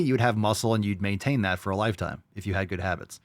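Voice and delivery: ordinary talk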